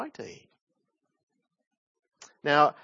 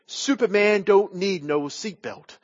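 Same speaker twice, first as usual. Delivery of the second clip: a light imitation of the boxer's American accent, a bit higher and louder; a hint of the boxer's accent, boasting to the flight attendant; Muhammad Ali accent; 4 semitones higher, 4 dB louder than usual. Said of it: "Sorry about the accent".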